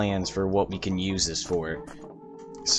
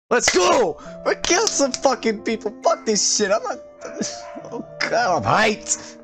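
hyped shouting; 12 semitones higher than usual. Real, not acted. Hyped after getting chainsaw.

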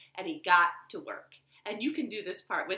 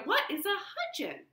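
higher, puzzled question; puzzled tailor; 11 semitones higher than usual. Acted. Tailor asks the wise woman, baffled and higher. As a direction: ask it baffled, pitch up. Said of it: "said the tailor".

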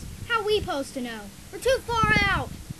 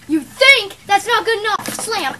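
loud, raised-pitch berating; angry villain outburst; 6 semitones higher, 13 dB louder than usual. Acted. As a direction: explode at a useless underling.

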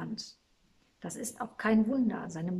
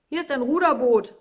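loud, raised-pitch call; calling from afar; 4 semitones higher, 10 dB louder than usual. A narrator's reading. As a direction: call across to the others. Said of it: "rief Joshua aus einiger Entfernung". (aus der Ferne gerufen)

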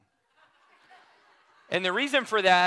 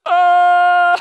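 sudden loud high gasp; loud high overwhelmed exclamation; startled cry; 14 semitones higher, 18 dB louder than usual. Real, not acted. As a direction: cry out as the presence hits. Overcome by the angel's presence.

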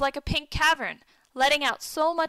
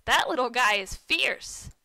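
plain reading voice

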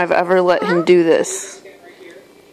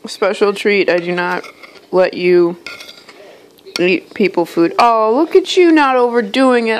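ordinary talk, nothing marked

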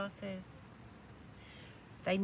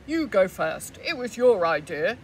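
much louder, bossy big goat; 14 dB louder than usual. Acted. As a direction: push the little one forward. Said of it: "said the big billy goat Gruff".

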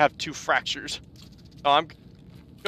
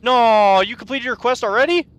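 high, loud disbelief; loud jealous surprise; surprised outburst; 9 semitones higher, 5 dB louder than usual. Real, not acted. Teammate finished quest first.

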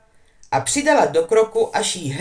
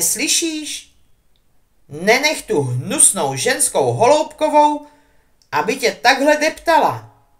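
loud, higher, insistent calling; yelling through the door; 5 semitones higher, 5 dB louder than usual. A narrator's reading. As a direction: pound the door and shout him out. Hermione yells at Hagrid's door. (naléhavě křičí přes dveře)

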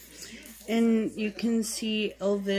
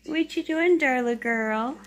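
high cooing voice to a kitten; higher cooing pet talk; 4 semitones higher, 5 dB louder than usual. Real, not acted. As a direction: coo up high at the cat.

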